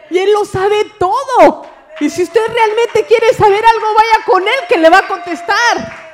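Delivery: shouted, high-pitched proclamation; full-force preaching. Real, not acted. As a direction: cry it out to the congregation, loud and high.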